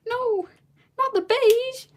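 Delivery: very high, loud cry of dismay; mock horror. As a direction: wail it as if beige were a catastrophe.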